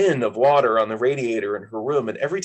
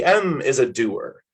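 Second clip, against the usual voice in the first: usual speaking voice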